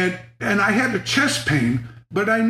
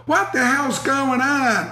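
higher, slow fake outrage mirroring him; mock echo; 6 semitones higher, slower than usual. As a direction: echo his words with put-on innocence. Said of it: "I would go".

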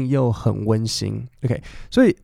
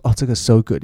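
so said louder, pushed; stress on so; 4 dB louder than usual. Shown as a demonstration. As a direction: lean hard on "so" in "so good". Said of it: "那个so一定要用力". ("so"一定要用力)

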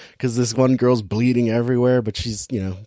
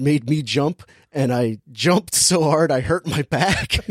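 ordinary talk

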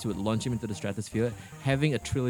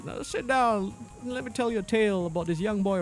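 lightly voiced old grandpa, higher; old grandpa storyteller; 8 semitones higher than usual. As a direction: play grandpa settling in to tell a tale. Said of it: "Yes, Grandpa Shafiq?".

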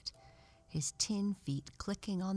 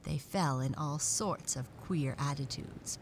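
usual speaking voice

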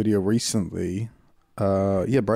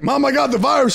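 high, raised comic confession; mock alarm; 13 semitones higher, 5 dB louder than usual. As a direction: break bad news in fake panic. Acted phone call to mom.

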